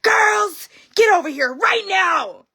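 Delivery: put-on high, loud, slow shout calling the girls; mom shouting. Furious mom yelling for the girls to line up. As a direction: bellow it like an angry mom summoning the kids. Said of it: "and she said".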